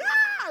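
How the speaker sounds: shrill scream, very high and loud. Retold or imitated. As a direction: scream and run. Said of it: "we were like".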